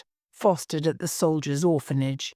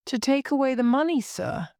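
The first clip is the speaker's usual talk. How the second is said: quick, higher little maid voice; earnest, lightly voiced servant girl; grave explanation; 6 semitones higher than usual. A narrator's reading. Maid explaining to her lawyer, mildly grave. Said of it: "explained little Million gravely".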